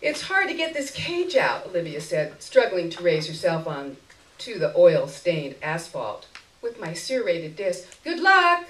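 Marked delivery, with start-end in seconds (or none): 0.0-1.7 higher, straining, with a laugh. Acted. Olivia struggling with cage. 8.0-8.6 much higher, loud, eager to leave; bright farewell. Acted. Cheerful parting.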